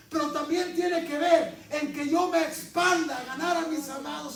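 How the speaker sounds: high, loud fervent preaching; preacher exhorting the congregation loudly. Real, not acted. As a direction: drive the point home. Preaching at full force.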